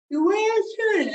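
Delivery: exaggerated chewing, voice climbing as the gum grows; pretend chewing gum. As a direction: talk round an ever-bigger wad of imaginary gum. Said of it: "It barely fits in your face".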